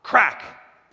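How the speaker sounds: loud, high, explosive cry of "crack"; high shouted sound effect; belt crack. Acted. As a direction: shout it like a sudden whip strike. Narrator voices each belt blow.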